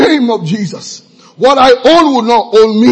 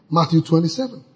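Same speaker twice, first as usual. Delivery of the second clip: ordinary talk, nothing marked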